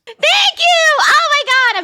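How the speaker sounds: high, loud gush of thanks; bursting with happy excitement; overjoyed squeal. Real, not acted. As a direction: squeal your thanks, overcome with joy. Overjoyed reaction to birthday video.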